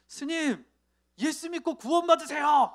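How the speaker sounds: sudden shout. Retold or imitated. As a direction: blurt it out all at once, then flee. Driver blurts gospel at monk.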